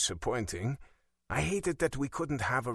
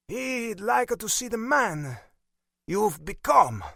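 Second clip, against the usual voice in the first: put-on slow, high Italian-accented disdain; disgusted; 11 semitones higher, 5 dB louder, slower than usual. A narrator's reading. Father judging what his son became, disgusted. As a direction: draw it out with contempt. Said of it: "barely able to control the lip curl, indicating his disgust".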